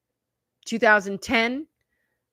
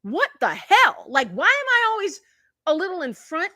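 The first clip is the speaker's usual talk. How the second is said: retold disbelief; 8 semitones higher than usual. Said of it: "And I was just like".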